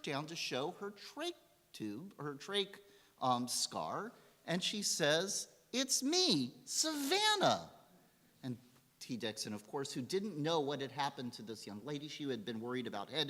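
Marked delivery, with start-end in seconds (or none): 5.7-7.7 high, stretched-out joyful announcement; excited reveal. Retold or imitated. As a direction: announce yourself with delighted glee. Teenager reveals herself to her doctor.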